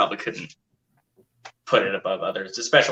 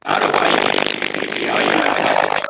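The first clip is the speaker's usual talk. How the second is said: slow crunchy robotic audio; garbled distorted mic; 13 semitones lower, much slower than usual. Real, not acted. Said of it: "very, very crunchy".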